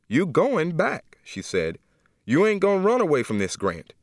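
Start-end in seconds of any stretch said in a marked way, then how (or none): 0.1-1.2 higher, very slow, firm command; insistent. Acted. Aunt insisting. 2.2-3.9 higher, stern insistence; an aunt laying down the law; firm scolding. Acted.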